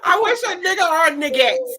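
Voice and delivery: mock tough, cracking up; playful tough talk between laughs; laughing defiance. Real, not acted. Defiant joke about protecting sister.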